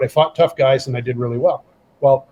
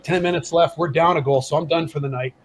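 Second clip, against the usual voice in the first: normal talking voice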